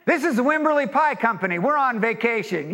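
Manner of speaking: high, upbeat imitated phone greeting; answering machine message. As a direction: play a cheery Texan voicemail. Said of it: "this is what their message machine will say".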